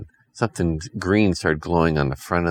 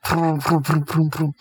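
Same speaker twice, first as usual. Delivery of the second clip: vroom sound effects; 10 semitones higher than usual. Acted. Launching torpedo sounds.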